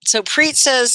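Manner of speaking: ordinary talk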